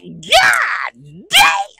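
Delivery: high, loud shouted curse; retold angry shout. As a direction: belt it out in frustration. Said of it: "I was like".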